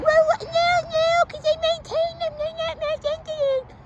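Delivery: extremely high caricature of an arguing Karen; Karen lady. Retold or imitated. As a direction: argue back in a ridiculous squeaky Karen voice. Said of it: "you don't have to be a Karen about it".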